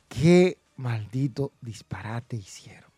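slow, low, half-whispered disbelief; disgusted verdict. Real, not acted. Wrestling fan condemning a booking decision, slow hushed disgust. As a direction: draw each word out in disgust.

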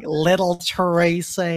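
slow, soft "Little Tracy" in a light American accent; flat English version. Shown as a demonstration. Showing the dub's nickname lacks punch, slow and soft. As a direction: say "Little Tracy" slowly and quietly, without punch.

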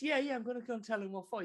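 fast, high and eager. Acted. Mother hurries to confront driver, fast and high. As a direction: gabble it, high and keen. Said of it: "he goes".

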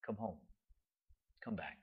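very soft, low, hushed call; barely audible, tender summons; gentle whisper. Acted. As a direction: whisper it close, with space between the phrases. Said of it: "he's gonna whisper to us".

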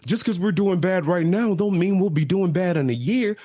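put-on high, cooing sweet-talk to a drunk partner; soothing boyfriend. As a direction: coax her down like a sweet-talking boyfriend. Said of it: "He's like".

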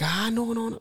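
mocking the friends who would have stopped him, high and prissy; mock pleading. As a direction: squeak out a prissy objection. Imitating friends' soft protest.

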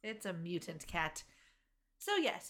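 ordinary delivery

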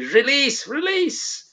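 high, strained Scottish "Release"; mock Scottish accent. Jodie Whittaker struggling in handcuffs, high Scottish accent. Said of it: "trying to do a Scottish accent".

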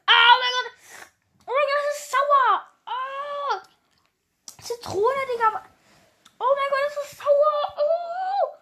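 very high, loud squealing shouts; laughing half-crying screams; shrieking at the sourness. Real, not acted. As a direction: cry out as the sour taste burns. Eating extremely sour lemon candy.

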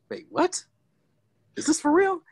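high-pitched mock bewilderment; comically high, incredulous questions; James Earl Jones in disbelief. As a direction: squeak it out, baffled. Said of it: "was all like".

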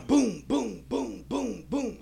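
high booms in a steady run; imitated banging. Storyteller mimics the nonstop banging, punchy high booms. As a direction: boom it out like repeated bangs.

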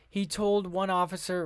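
ordinary talk

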